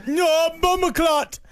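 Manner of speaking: put-on Jamaican accent. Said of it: "I haven't done a Jamaican accent".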